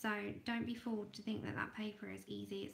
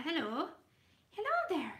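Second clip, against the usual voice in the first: put-on a shaky try at an Irish accent, higher and louder; hammed-up Irish accent greeting a viewer; attempted Irish accent; 5 semitones higher, 6 dB louder than usual. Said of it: "I can't do the Irish accent to save my life, but I try".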